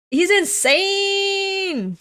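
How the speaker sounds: a very high, loud, stretched-out wail; horrified cry. Acted. Soldier shrieking that the man is insane, very high and slow. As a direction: drag the cry out in disbelief. Character screams in horror.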